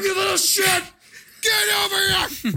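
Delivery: very high, loud acted scream; hammed-up raging headmaster; screaming Dumbledore. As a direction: screech it across the room in mock fury. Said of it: "You don't hear Brits scream like that usually".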